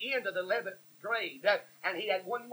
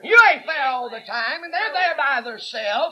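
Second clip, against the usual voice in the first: loud preaching; 2 semitones higher, 8 dB louder than usual. Real, not acted. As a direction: scold the congregation from the pulpit. Preacher scolding parents about cable TV.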